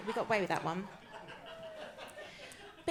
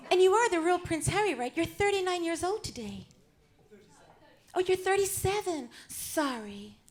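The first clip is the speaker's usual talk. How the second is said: lightly voiced Hollywood starlet, lifted pitch; a hint of screen-siren impersonation; Marilyn Monroe; 5 semitones higher than usual. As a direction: play the starlet chatting to her audience.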